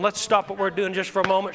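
usual speaking voice

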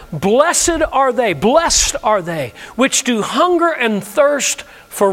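very slow, solemn, stretched words; slow scripture reading. Shown as a demonstration. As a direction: read it with weight.

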